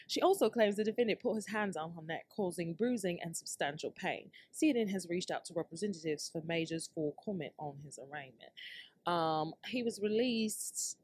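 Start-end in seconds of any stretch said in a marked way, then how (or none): none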